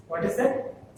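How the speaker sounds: usual speaking voice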